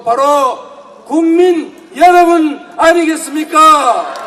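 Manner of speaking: slow, loud rousing question; fiery revolutionary speech. Real, not acted. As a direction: rouse the crowd, slowly.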